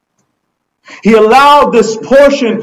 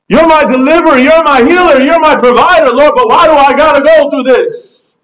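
higher-pitched, insistent plea; pleading with God; 3 semitones higher than usual. Acted. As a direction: cry out a protest to God in an unbroken rush. Acted desperate plea to God.

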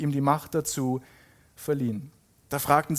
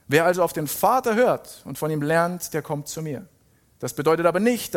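raised, emphatic proclamation, easing at phrase ends; dramatic scripture reading; 4 semitones higher, 3 dB louder than usual. Acted. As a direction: declare it with firm conviction. Reads Jesus' speech emphatically. (nachdrücklich verkündende Bibellesung)

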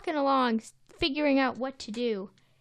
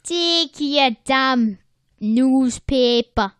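put-on New York accent; 7 dB louder, slower than usual. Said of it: "that just lost it at the end".

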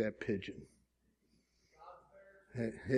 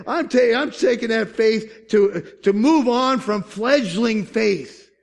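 raised, shouted declarations; fervent preaching; 9 semitones higher, 9 dB louder, slower than usual. Real, not acted. Pastor defying doubters before the church, full-force preaching. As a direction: punch out the repeated don't, loud and high.

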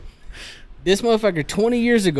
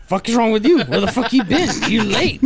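retold angry scolding; 6 semitones higher than usual. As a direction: lay into a latecomer, playing it for laughs. Acts out brother scolding him.

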